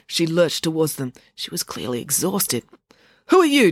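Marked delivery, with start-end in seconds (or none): 3.3-3.7 loud, high-pitched demand. A narrator's reading. Miss Klaus to the woman who burst in, demanding. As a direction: demand it sharply, voice raised. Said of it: "demanded Miss Klaus".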